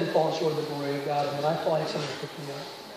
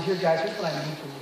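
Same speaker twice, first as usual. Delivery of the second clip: usual speaking voice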